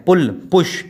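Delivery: "पुल, पुश" with a brief u; short u. Shown as a demonstration. Teacher giving short-vowel examples, brief "पुल, पुश". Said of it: "छोटा ऊ".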